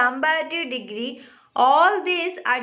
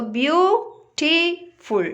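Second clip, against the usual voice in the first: "beautiful" very slowly, in three detached beats; syllable by syllable; much slower than usual. Shown as a demonstration. Teacher showing children three syllables, "beautiful" broken into slow parts. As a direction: leave a clear gap after beau and ti in "beautiful". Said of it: "Like this way we have to pronounce it".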